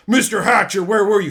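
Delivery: loud public scolding; loud call-out. Retold or imitated. Manager calling him out before everyone, loud. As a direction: announce it for the whole room. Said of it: "Saying it loudly for everyone".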